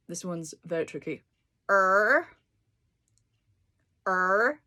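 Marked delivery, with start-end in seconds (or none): 1.7-4.6 "Er" with the tongue curled back, said loud; retroflex er. Shown as a demonstration. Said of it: "They call it "juanshe," which means to curl up your tongue".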